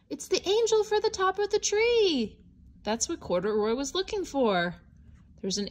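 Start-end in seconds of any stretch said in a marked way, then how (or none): 0.1-2.4 high excited reveal; light up as you find the angel; excited discovery. Acted. Reader telling children the angel is found, excited.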